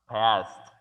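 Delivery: passed said loud, ending in ST; passed as one syllable, "past"; final T sound. Shown as a demonstration. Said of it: "Suena más como la palabra pasado".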